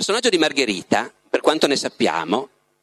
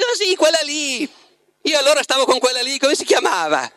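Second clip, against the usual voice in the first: high, offhand mock recollection; mock forgetful author; 9 semitones higher than usual. Imitating Bulgakov on his former wife, mock-forgetful. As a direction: imitate him dismissing an ex, high and quickening. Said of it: "parlando della moglie precedente, dice".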